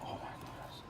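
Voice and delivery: a breathy, very soft whisper; hushed awe for the viewers; whispered amazement at the sound; ASMR whisper. Acted. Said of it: "This is an A S M R".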